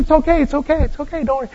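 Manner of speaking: calm a nervous friend quietly; hushed reassurance. Acted. Host reassures embarrassed friend.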